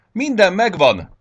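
high, loud, drawn-out cry; shouted announcement. A narrator's reading. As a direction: shout it out triumphantly to the whole yard. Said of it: "kiáltotta Csuszpájsz".

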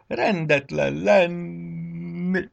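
high, very drawn-out wail; long tearful howl; heartbreaking sobbing wail. A narrator's reading. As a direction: wail it like a crying little bunny. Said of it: "hangzott fel a szívszaggató zokogás".

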